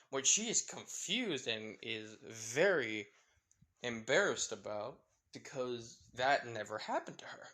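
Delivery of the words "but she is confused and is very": ordinary delivery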